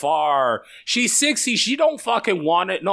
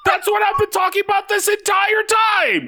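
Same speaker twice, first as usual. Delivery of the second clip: exasperated shout; 14 semitones higher than usual. Real, not acted. Friend correcting the lasagna misunderstanding, laughing shout. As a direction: shout it through laughter, fed up.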